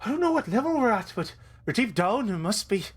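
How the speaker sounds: high, lilting Irish voice; Biff. Acted. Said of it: "no, that's the voice".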